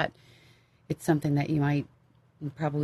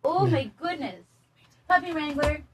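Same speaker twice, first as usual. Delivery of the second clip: much higher, cooing puppy voice; 11 semitones higher than usual. Real, not acted. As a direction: gush over the puppy sweetly.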